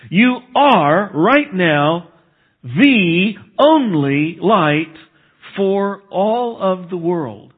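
you are right now the only light, each word drawn out very slowly; slow it right down and press every word home; slow emphatic climax. Acted.

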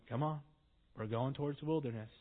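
just wave them on and keep walking; weary. Retold or imitated. David telling his men to move on, soft and weary. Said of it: "He's like".

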